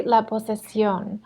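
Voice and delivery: usual speaking voice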